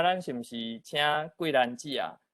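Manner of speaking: ordinary talk, nothing marked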